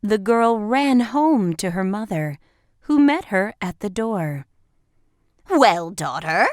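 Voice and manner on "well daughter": impatient. A narrator's reading. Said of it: "impatient to hear her speak".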